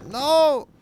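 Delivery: high startled cry; shocked shout. Real, not acted. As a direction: yell out in shock. Shock in game. (entsetzter Aufschrei)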